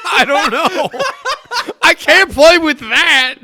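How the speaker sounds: high, laughing exclamation; laughing protest. Real, not acted. Co-host teased about fidgeting, loud laughing protest. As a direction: protest loudly through real laughter.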